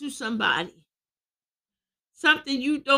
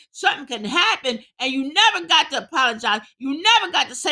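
heated, higher-pitched preacher's scolding; preaching; 4 semitones higher, 3 dB louder than usual. Real, not acted. Preacher warning married folks about bedtime anger, heated preaching. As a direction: preach it hard, pushing each warning.

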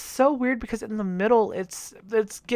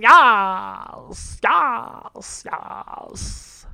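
crowd cheering yes; 2 semitones higher, much slower than usual. Retold or imitated.

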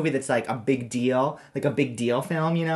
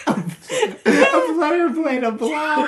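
silly intro; 13 semitones higher, 4 dB louder, much slower than usual. Acted.

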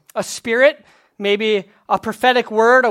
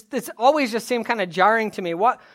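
normal talking voice

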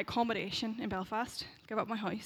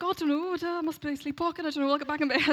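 higher, louder acted excuse-making; flustered excuse; 6 semitones higher, 5 dB louder, faster than usual. Caught-out friend excuses herself to roommate, high flustered bluff. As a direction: bluff your way out, pitched up. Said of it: "I was like".

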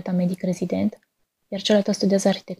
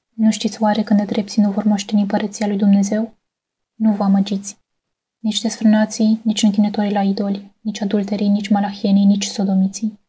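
loud, stern preaching of the verse; declaimed scripture; 5 dB louder than usual. Acted. Quoting scripture against sinners, stern loud preaching. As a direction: thunder it out like a warning from the pulpit.

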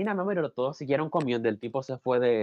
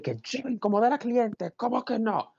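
mock indignant man; 8 semitones higher than usual. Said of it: "Y él le dijo".

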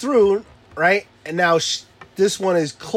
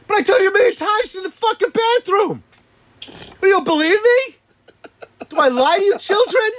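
much higher, louder incredulous rant; mock exasperated rant; 4 dB louder than usual. Father ranting at his kids who don't believe him, much higher and louder.

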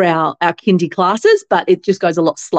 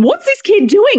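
much higher, incredulous imitation; astonished speech therapist; 13 semitones higher, 4 dB louder than usual. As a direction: quote a stunned therapist, voice pitched way up. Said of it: "he's going".

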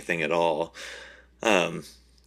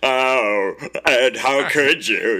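high, loud, slow wail with laughter; mock betrayed wife; 7 semitones higher, 9 dB louder, slower than usual. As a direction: ham up the heartbroken accusation. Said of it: "That was pretty good".

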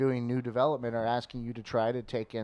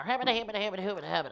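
gruff prospector; 8 semitones higher, faster than usual. Shown as a demonstration. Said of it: "that Prospector's more".

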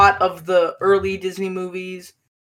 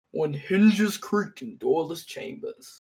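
slow, eerie ghost host narration; 5 dB quieter, slower than usual. Retold or imitated. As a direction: draw it out like a haunted mansion host. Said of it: "the ghost host in the Haunted Mansion".

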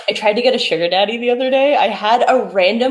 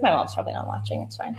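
muttering aside; 9 dB quieter, faster than usual. Retold or imitated. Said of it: "but I'm just like".

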